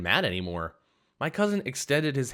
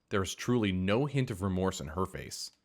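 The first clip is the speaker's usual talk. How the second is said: plain reading voice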